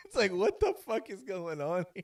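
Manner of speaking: usual speaking voice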